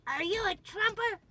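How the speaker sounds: a shrill-free but high nagging echo; accusing old lady. Retold or imitated. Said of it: "she's just all over".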